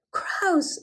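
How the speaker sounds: a high delighted cry of crows; sudden happy recognition; exclaiming. A narrator's reading. Uncle Wiggily spotting his crow friends, high exclamation. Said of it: "exclaimed Uncle Wiggily".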